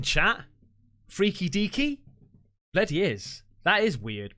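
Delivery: ordinary talk, nothing marked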